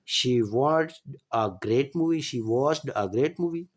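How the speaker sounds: each word laid out slowly for students; very slow repeated example; very slow dictation. Shown as a demonstration. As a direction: read it slowly twice so they catch it. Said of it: "teacher repeating example slowly".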